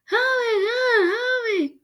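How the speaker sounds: high, muffled crying plea; mouth stuck mumble. A narrator's reading. Boy frozen to the mirror begs for help, muffled. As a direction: whimper for help through stuck lips. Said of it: "sounding like he had something in his mouth".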